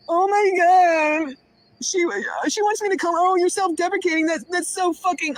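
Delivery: high, exaggerated scandalized mimicry; mocking imitation. Woman mimicking the one calling her self-deprecating, mocking. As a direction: whine it high to ridicule the critic.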